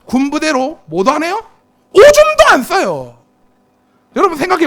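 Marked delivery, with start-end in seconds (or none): 1.9-3.2 slow, higher, louder punchline; comic emphasis. Pretend. Preacher jokes to veterans in the pews, mock emphatic. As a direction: land the joke slowly with a lift. Joke about discharged soldiers.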